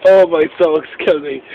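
usual speaking voice

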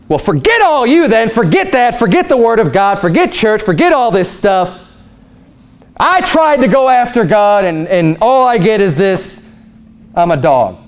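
high-pitched indignant outburst, acted; angry, upset. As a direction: play someone fed up and storming off from church. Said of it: "you can get angry, you can get upset".

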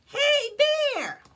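very high, loud critter voice; squeaky friend leading the way; friend calling. Acted. Friend calls Bear to follow, very high and loud.

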